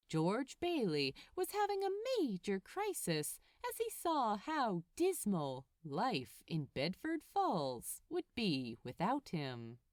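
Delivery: usual speaking voice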